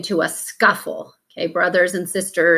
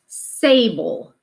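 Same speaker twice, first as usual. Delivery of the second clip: a slow, clear teacher read of "sable"; slow it down so they hear the long A; 2 semitones higher than usual. Shown as a demonstration. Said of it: "did you notice when we said that it's sable".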